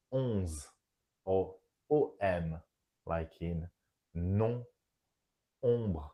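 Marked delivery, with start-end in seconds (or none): none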